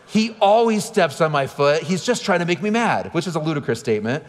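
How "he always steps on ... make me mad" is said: high, slow whining daughter; whiny kid complaint. Retold or imitated. Daughter blames her brother to dad, high whiny complaint. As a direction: complain like a wronged little girl. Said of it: "she says".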